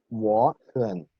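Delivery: "wo hen" with "wo" rising, "hen" low; paired third tones. Shown as a demonstration. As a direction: pitch "wo" above a sunken "hen". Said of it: "más agudo que el segundo". ("wo hen", dos terceros tonos, el primero más agudo)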